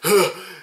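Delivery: hammed-up sharp gasp; mock dramatic intake of breath; intense gasp. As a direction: gasp like an overacting movie hero. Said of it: "he really gets intense".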